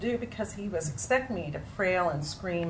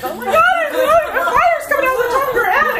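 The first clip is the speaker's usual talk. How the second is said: high, loud, laughing; laughing about her friend's temper; laughing exclamation; 15 dB louder than usual. Retold or imitated. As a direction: squeal it through laughter. Laughing retelling of friend's fury.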